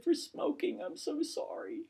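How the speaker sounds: high, tearful imitation of the grieving mother. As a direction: voice lifts and cracks with her apology. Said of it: "She said".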